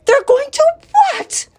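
high, loud shriek of disbelief; shocked, squealing outburst; horrified scream. Acted. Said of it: "screamed Wilbur".

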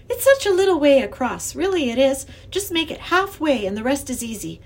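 put-on encouraging young girl. A narrator's reading. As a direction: talk the professor across the bridge. Lindy talks him across.

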